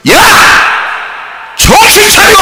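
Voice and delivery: sharp, high shout to snap him out of it; rebuking shout. Acted. Pastor rebuking the devil before the congregation, high shout. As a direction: yell at the devil to wake up.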